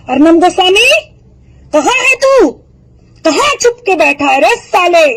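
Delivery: taunting a TV anchor to show himself, a high angry call. Real, not acted. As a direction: call him out, pitch up and push. Calling out to Arnab Goswami.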